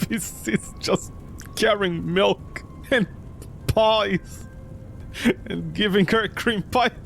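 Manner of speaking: ordinary delivery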